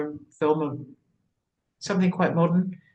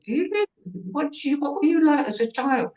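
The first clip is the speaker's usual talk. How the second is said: squeaky friends; 12 semitones higher than usual. Retold or imitated. Friends' questions, retold.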